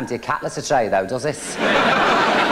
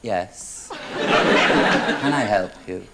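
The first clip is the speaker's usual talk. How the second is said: mock innocent; 5 semitones lower, much slower than usual. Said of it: "open the door and go".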